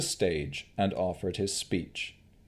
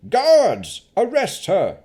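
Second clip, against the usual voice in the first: high, loud command; barked order; 13 semitones higher, 10 dB louder, slower than usual. Acted. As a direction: call the guards sharply. Baron orders guards.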